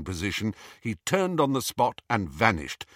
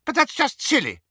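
alarmed; 5 dB louder than usual. Acted.

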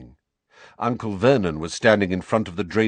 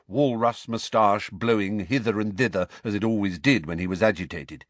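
ordinary delivery, nothing marked

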